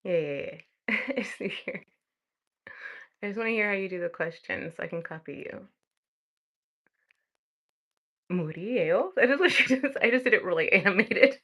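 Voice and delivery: laughing through the words; amused at herself; giggling self-commentary; giggly. Real, not acted. Laughing at own exaggeration.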